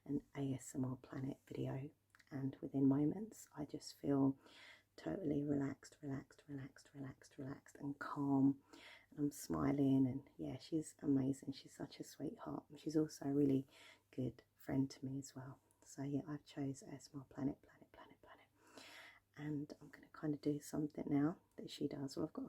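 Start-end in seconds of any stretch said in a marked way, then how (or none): 0.1-1.8 whispering. Acted. ASMR collab whispering. 6.0-7.8 soft repeated whisper. Acted. ASMR word repetition trigger. 15.8-18.5 ASMR whisper. Acted. ASMR repetition trigger.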